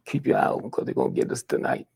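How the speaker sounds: hushed whisper, retelling a warning to a friend. Retold or imitated. Warning Medgar that they'll be hunted tonight, whispered. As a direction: lean in and breathe the warning.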